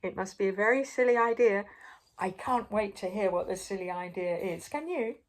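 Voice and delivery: plain reading voice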